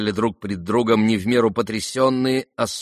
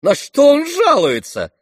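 high, loud protest; indignant cry; 11 semitones higher, 7 dB louder than usual. Acted. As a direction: cry out in outraged complaint. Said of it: "вскричал Ипполит, скаля зубы".

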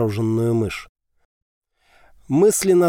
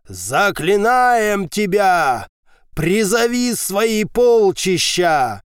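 ritual incantation; 6 semitones higher, 3 dB louder, much slower than usual. A narrator's reading. Priest's ritual invocation.

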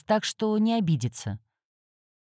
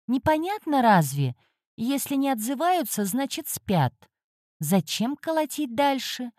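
indignant cat Khran; 6 semitones higher than usual. A narrator's reading. Cat-spirit complains to heroine about the knocking, indignant higher grumble. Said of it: "возмутился он".

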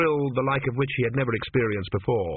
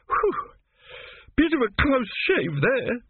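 relieved; 13 semitones higher, slower than usual. A narrator's reading. Relief after disposing of doubter.

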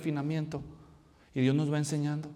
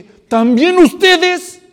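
outraged disbelief; 12 semitones higher, 16 dB louder, much slower than usual. Retold or imitated. Fariseos escandalizados.

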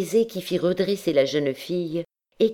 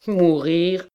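raised, mildly startled exclamation; exclaiming; 4 semitones higher, 4 dB louder than usual. A narrator's reading. Amorous captain protesting at talk of dying, exclaiming. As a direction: cry it out, higher, in protest. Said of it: "s'écria l'amoureux capitaine".

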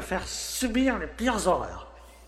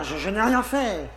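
ordinary talk

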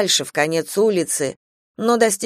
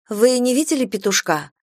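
higher-pitched searching question; a touch of worry in a raised voice; anxious question; 6 semitones higher than usual. A narrator's reading. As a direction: ask it hopefully, pitched up. Narrator voices own question to woman.

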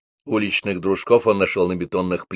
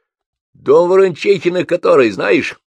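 put-on much higher, louder old man's question; old man asks; 10 semitones higher, 7 dB louder than usual. A narrator's reading. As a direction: ask the boys loudly about the house. Old man asks about house. (старик спрашивает высоко и громко)

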